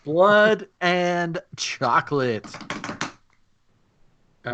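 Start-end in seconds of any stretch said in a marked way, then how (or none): 0.0-2.4 slow, drawn-out movie title read; dramatic title announcement. Acted. Host reading the title to players, slow and dramatic. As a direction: draw out the title like a trailer voice.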